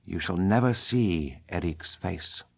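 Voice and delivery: low, slow and calm; serene. Acted. Eric calmly refusing Christine's demand, low and serene. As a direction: answer serenely, unhurried and low. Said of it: "He replied serenely".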